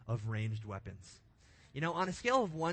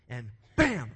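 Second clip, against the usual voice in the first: loud mock "bam" of a gun going off; imitated gunshot; 3 semitones lower, 11 dB louder than usual. Boy firing his BB gun at a pigeon, loud mock bam. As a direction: punch out a big movie gunshot. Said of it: "It didn't sound like that".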